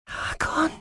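high, airy and weak; frail, near-tearful gasp of a word; breathed. Acted. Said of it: "hauchte sie".

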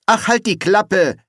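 high, loud, exasperated brush-off; annoyed outburst, pitched well up; irritated. A narrator's reading. Irritated Ginger dismisses the troll.

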